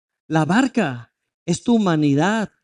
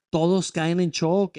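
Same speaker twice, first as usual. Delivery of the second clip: ordinary talk, nothing marked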